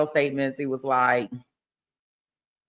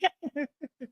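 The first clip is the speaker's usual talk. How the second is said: high, quiet giggle; real laugh, soft and high-pitched; 7 semitones higher, 8 dB quieter than usual. Real, not acted. Laughing at her own question.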